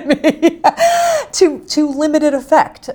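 real laugh breaking up the line. Real, not acted. Admitting her years of searching to her friend, laughing.

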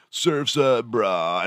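lightly imitated surfer dude, starting high; California surfer. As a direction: throw it out like a mock surfer. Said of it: "people were like".

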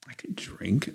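hushed whisper to himself; whispered inner thought. Retold or imitated. Recovering drinker whispering his own excuse.

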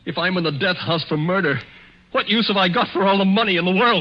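rising to a loud, high cry; despairing outburst. Acted. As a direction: build to a hopeless shout.

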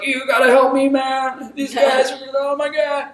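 high, frantic fake-American plea; panicked Hans Gruber act. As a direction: beg like a cornered villain faking panic. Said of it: "he puts on an American accent and it's like".

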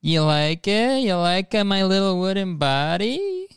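hammed-up little wooden boy showing off; Pinocchio. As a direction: play the puppet slowly, rising in pitch. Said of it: "He's like".

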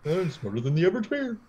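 high, goofy cartoon bear impression; Yogi Bear. Joking with co-hosts after the comparison, cartoon-bear voice. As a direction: rise through it like a boastful cartoon bear. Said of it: "sound like Yogi Bear".

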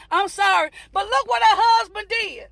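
ordinary delivery

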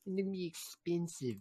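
lightly voiced cheeky Aussie bloke; mock Aussie accent. Mocking Ubisoft in Aussie voice.

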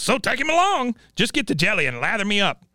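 high, squawky mock old man; Cotton impression. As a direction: cackle it out like a cranky old geezer. Voicing grandpa Cotton.

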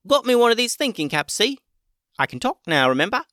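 high, fast squeaky critter voice; chirpy little critter, quick; guinea pig voice. A narrator's reading. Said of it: "said the guinea pig".

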